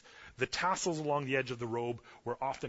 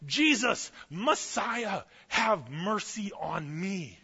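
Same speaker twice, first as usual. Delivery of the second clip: high cry easing into a slow plea; drawn-out beggar's cry, falling in pitch; retold yelling; 5 semitones higher, much slower than usual. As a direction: call out for mercy over a crowd. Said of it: "he's yelling out".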